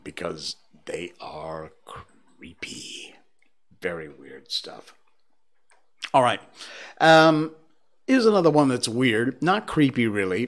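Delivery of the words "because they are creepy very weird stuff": slow, much softer whisper; spooky whisper. Real, not acted. Host warning listeners not to read them at night, whispering. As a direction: whisper it like a ghost story.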